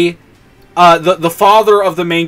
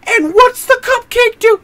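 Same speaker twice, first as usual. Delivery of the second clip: high, worked-up rhetorical question; exasperated high pitch. Real, not acted. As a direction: keep the frantic high voice. Still worked up after the yelling.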